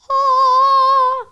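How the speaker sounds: loud, held high-pitched cry; long high "aah". Retold or imitated. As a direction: belt out one sustained, awestruck note. Imitates reaction to mother's arrival.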